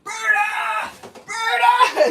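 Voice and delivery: high, drawn-out calling shout; retold screaming. Friends calling the lost dog in forest, mock screaming. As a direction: holler a name as if across a forest. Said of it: "are in the forest just screaming".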